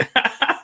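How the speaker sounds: real laugh. Real, not acted. Laughing at own repetition.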